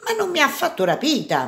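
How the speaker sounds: high, indignant objection; protesting. A narrator's reading. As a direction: protest the false accusation. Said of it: "protestò Jasmine".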